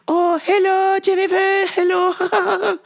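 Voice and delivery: imitating the mom's sing-song greeting. Mimicking her friend's mom greeting her at the store, high put-on voice. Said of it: "she goes".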